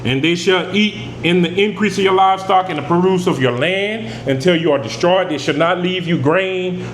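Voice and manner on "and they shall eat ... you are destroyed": ordinary delivery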